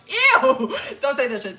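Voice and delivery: loud, high disgusted "ew"; disgusted shriek. Real, not acted. Vlogger shrieking at a gross detail, loud and high. Reacting to no showers.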